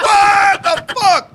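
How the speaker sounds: high, drawn-out mock yell; hammed-up shock. Man reacting to his girlfriend in bed, hammed-up yell. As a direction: stretch it into a high comic outcry.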